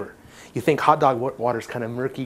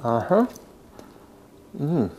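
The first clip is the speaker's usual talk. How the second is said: mouth full; slower than usual. Real, not acted. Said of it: "Don't talk with your mouth full," so I was waiting".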